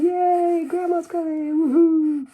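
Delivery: put-on very high, squealing kids' cheer; cheering kids. Kids cheering that Grandma is coming, very high. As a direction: whoop it up like thrilled children. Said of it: "the kids coming and saying".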